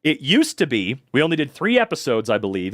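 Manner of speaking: ordinary delivery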